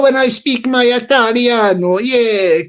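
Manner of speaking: ordinary delivery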